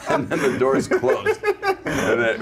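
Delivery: words breaking up with laughter; laughing. Real, not acted. [name] finishing the Rodney story, laughing as he goes. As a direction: talk through the giggles. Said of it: "we both laughed out loud".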